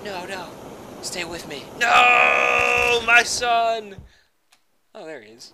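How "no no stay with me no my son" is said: hammy dramatic wail, louder and higher; mock anguished cry. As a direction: overplay a parent's despair. Mock dramatic grief as character dies.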